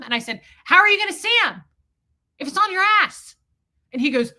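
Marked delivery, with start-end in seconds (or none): 0.6-3.4 high, loud challenge; reenacted exasperated question; incredulous comeback. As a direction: throw it back louder and higher, disbelieving. Retold argument, incredulous comeback.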